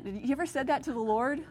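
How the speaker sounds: ordinary delivery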